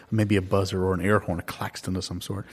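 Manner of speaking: ordinary talk, nothing marked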